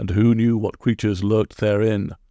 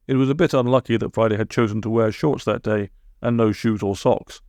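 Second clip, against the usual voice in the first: plain reading voice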